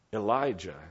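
normal talking voice

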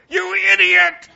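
high, loud mock road-rage shout; imitating dad yelling at other drivers from his car; angry driver yell. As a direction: bark it like a gentle dad gone furious at the wheel. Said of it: "was a maniac behind the wheel".

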